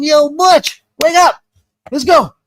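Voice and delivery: high, loud call to wake him; retold yell at the dazed uncle. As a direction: shout his name to rouse him. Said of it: "And I start yelling".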